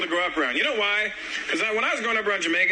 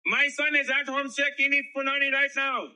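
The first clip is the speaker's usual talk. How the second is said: higher, eager mock Indian dad; Indian dad at the store; 7 semitones higher than usual. Dad asks the Jamaican shop lady for punani, eager mock Indian accent. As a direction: ham up an eager immigrant father asking for fruit. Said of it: "My dad went to the Jamaican store".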